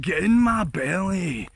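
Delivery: slow, drawn-out greedy glutton voice; Fat Bastard impression. Asked for Fat Bastard, answering in his slow glutton voice. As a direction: ham up the hungry villain, slowly. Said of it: "can you do Fat Bastard impressions?".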